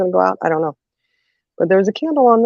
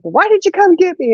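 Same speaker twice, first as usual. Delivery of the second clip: mom questioning; 13 semitones higher than usual. Retold or imitated. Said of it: "she's like".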